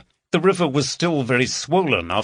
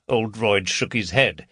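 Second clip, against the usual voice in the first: ordinary delivery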